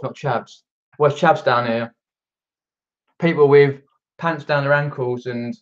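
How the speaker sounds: normal talking voice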